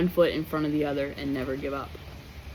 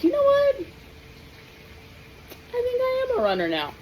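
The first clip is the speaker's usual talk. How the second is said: high, bright proud exclamation; suddenly thrilled with yourself; excited self-talk; 4 dB quieter than usual. Retold or imitated. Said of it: "I was like".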